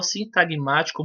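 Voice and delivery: ordinary delivery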